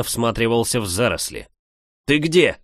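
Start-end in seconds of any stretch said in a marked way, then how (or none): 2.0-2.6 higher, louder urgent call; shouting. A narrator's reading. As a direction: call out to find her. Calling out in the forest.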